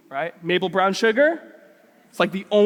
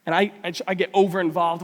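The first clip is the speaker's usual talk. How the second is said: ordinary delivery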